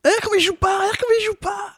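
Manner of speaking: very high outraged mockery; mocking squeal. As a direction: squeal at the bad acting. Mocking bad acting, high squeal.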